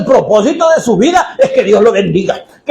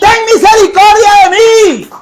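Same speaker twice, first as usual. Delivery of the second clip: higher, a little louder declaration of faith; fervent shout; 10 semitones higher, 4 dB louder than usual. Retold or imitated. Blind man shouting his faith to Jesus, higher and louder. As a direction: cry it out as a bold confession. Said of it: "Ese hombre gritaba".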